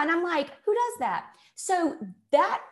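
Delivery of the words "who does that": high, loud disbelief; incredulous. Retold or imitated. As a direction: ask it in disbelief. Quoting her own disbelief.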